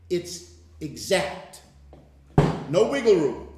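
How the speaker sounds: slow "exact", then loud "No wiggle room"; emphatic. Real, not acted. Preaching absolute truth, emphatic and loud. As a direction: land it hard and final. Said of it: "emphatic close".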